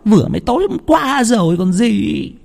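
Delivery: very high, whiny complaining voice; sleepy wife complaining to her jumpy husband; grumpy wife. Acted. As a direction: grumble at being woken. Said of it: "Vợ càu nhàu".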